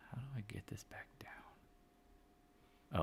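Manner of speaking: slow whisper to himself. Real, not acted. Trader whispering to himself over a broken chart, slow whisper. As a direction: mutter it under your breath slowly.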